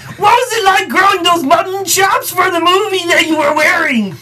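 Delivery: high, strained comic voice; squeaky, manic question; Bobcat voice. Retold or imitated. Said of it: "I apologize for Bobcat".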